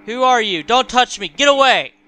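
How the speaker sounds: loud, high, frightened protest; panicked shouting. Acted. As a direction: shout to fend someone off. Confused mother fends off staff.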